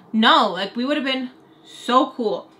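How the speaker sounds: ordinary talk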